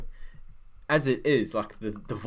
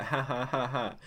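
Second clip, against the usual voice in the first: mock chuckle in even beats; demonstrated chuckle; 2 semitones higher, 4 dB quieter than usual. Shown as a demonstration. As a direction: spell out a restrained chuckle. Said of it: "I guess I'll chuckle".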